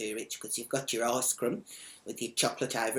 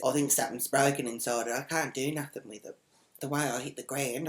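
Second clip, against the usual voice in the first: ordinary delivery, nothing marked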